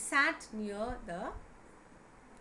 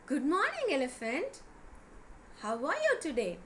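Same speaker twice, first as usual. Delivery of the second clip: bright-eyed eager greeting, higher and louder; happy and eager; 5 semitones higher, 4 dB louder than usual. A narrator's reading. Rabbit greets the elephant on the road, happy and eager. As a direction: greet with loud, high-pitched happiness. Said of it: "with so much of happiness and eagerness".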